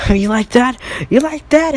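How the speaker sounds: high, loud jeer; cocky, high-pitched yell; gloating taunt. Acted. Baku gloats over trapped villain.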